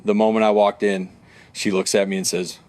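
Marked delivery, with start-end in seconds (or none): none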